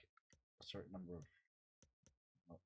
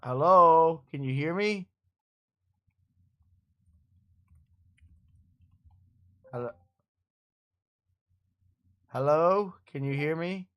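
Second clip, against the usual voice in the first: calling out; 5 semitones higher, 10 dB louder than usual. Real, not acted. Testing mic, calling friend.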